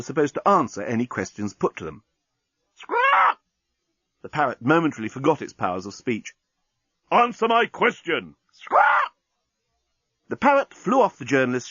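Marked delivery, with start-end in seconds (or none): 2.7-3.4 very high shrieking parrot squawk. A narrator's reading. Parrot squawking at the captain, very high. 7.1-8.4 high, slow, loud shout; shouted order. A narrator's reading. Captain shouting at parrot, loud. 8.5-9.1 very high shrieking parrot squawk. A narrator's reading. Parrot squawking at the captain, very high.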